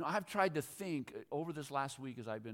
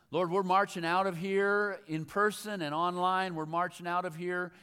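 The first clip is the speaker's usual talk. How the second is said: closing prayer; 5 semitones higher, 10 dB louder than usual. Acted. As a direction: pray the church out with force.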